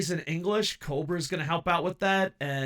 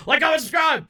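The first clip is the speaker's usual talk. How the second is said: shouting; 13 semitones higher, 11 dB louder than usual. Acted. Shouted outro call to viewers.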